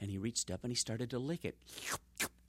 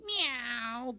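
high cat meow; 9 semitones higher than usual. Acted. Cat luring mouse.

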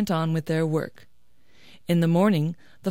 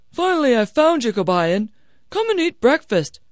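loud, higher-pitched hail; calling out; 6 semitones higher, 6 dB louder than usual. Acted. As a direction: call him in to breakfast. Father-in-law calls to Kabayan.

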